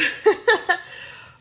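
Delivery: loud unvoiced high giggle. Real, not acted. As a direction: giggle at the Pisces excuse. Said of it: "blame it on me being a Pisces".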